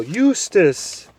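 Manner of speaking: high, loud owlish hoot of a voice. Acted. Owl hooting up at the deaf dwarf.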